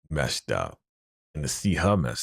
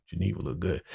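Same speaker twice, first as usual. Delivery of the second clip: ordinary talk, nothing marked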